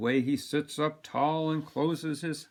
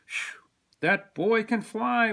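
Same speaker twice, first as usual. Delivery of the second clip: high, slow cheer for a favorite; a touch of wowed fan; admiring cheer; 7 semitones higher, 3 dB louder than usual. A narrator's reading. Man cheering his favorite player's basket, admiring. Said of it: "one man cheers when his favorite player".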